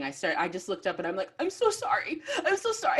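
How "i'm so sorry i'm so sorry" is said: very high, squeaky mock sobbing; retold crying. Fan apologising to the author for crying, tearful. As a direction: blubber an apology, hammed up. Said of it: "I just started crying".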